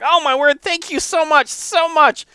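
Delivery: very high, loud gushing thanks; squeaky-high thankful monk; overjoyed gratitude. Acted. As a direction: gush with delighted relief, way up high. Monk overjoyed at mended socks.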